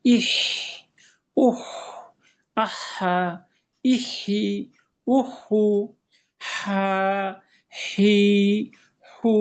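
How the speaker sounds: "ইহ, উহ" with a running breath on the h; air keeps flowing. Shown as a demonstration. Teacher drills ha for learners, continuous airy h. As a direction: push steady air through each h in "আহা, ইহি, উহু". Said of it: "বাতাসটা চলমান থাকবে।".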